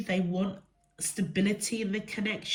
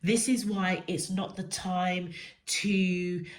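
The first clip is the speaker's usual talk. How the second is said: ordinary talk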